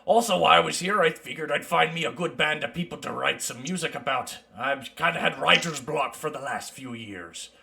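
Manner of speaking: lightly voiced mountain dwarf; easygoing dwarf traveler.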